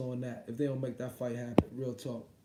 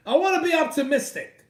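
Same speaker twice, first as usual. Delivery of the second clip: much higher, louder strained cry; exasperated yell; 10 semitones higher, 7 dB louder than usual. Real, not acted. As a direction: cry it out in despair. Frustrated cry to chat.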